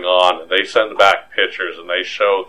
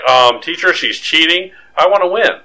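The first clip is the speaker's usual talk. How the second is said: put-on whiny tattletale kid; 8 semitones higher than usual. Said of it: "I'd be like".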